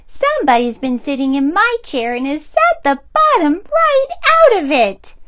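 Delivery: put-on very high squeaky baby bear; tiny bear, upset his food is gone; squeaky little cub wail; little small wee voice. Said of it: "in his little small wee voice".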